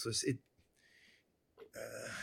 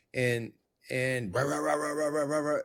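imitated dog barking; 3 semitones higher than usual. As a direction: bark it out like the Great Dane, lightly.

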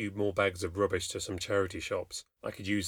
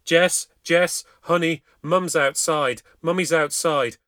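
raised, higher calls growing louder; helpless shouting; 5 semitones higher, 8 dB louder, slower than usual. A narrator's reading. Locked-out mum calling to her daughter inside, helpless shouting. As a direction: call desperately through a locked door. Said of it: "Helpless, I started to shout".

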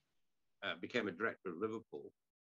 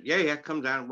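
panicked eagerness; 6 semitones higher, 9 dB louder than usual. Retold or imitated. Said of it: "so they were panicking".

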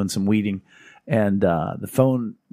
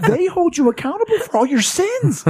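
mock warning. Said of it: "I said".

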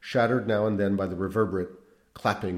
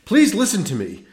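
high, loud impatient order; impatient command; 10 semitones higher, 10 dB louder than usual. A narrator's reading. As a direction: snap it impatiently. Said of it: "commanded the priest impatiently".

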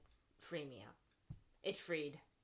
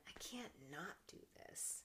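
hushed resolve; 13 dB quieter than usual. Retold or imitated. Retelling her own decision.

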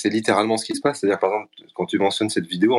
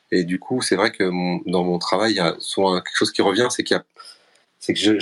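ordinary talk, nothing marked

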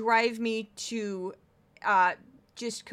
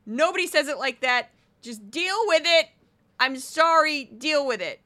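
loud, raised, fed-up retort; exasperated; 4 semitones higher, 7 dB louder than usual. Acted. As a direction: push back loudly, out of patience. Arguing back about her name.

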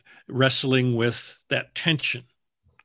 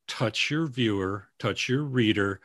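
ordinary talk

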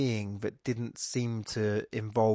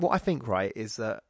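normal talking voice

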